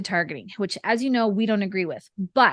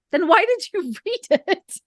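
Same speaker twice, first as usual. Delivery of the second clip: laughing disbelief; 9 semitones higher, 3 dB louder than usual. Retold or imitated. Retold reply, laughing.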